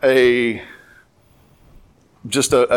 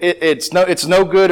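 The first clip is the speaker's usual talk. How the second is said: usual speaking voice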